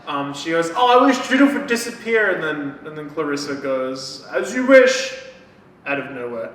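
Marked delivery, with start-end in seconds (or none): 0.8-2.3 high, loud mock whine; exasperated wish. Girl wishing her teammate gone, exasperated. 4.3-5.2 high, loud theatrical pronouncement; dramatic wish granter. Retold or imitated. Witch appearing from nowhere to grant the wish, dramatic.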